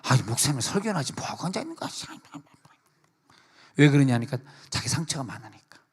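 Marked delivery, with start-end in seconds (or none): 0.0-1.9 fast complaining whisper; quick whispered mock grumble; grumbling whisper. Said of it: "어떤 사람은".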